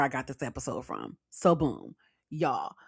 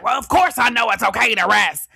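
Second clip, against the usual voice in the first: loud, higher indignant comeback; mock defensive retort; 5 semitones higher, 7 dB louder than usual. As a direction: snap back as the offended workaholic. Acts defensive workaholic's reply.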